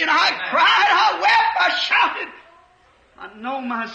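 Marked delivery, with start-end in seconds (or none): 0.0-2.3 ecstatic outburst. Retold or imitated. Recalling ecstatic release in prayer.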